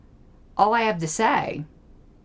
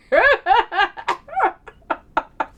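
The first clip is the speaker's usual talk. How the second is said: high, trailing cackle; real laughter; 12 semitones higher, 4 dB louder than usual. Real, not acted. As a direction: laugh hard until it peters out. Cracking up at the joke.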